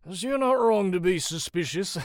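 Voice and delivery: slurred, boozy captain; tipsy rogue, tickled by the suspicion; drunken amusement. A narrator's reading. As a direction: slur it loudly, building into a chuckle. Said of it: "The man chuckles drunkenly".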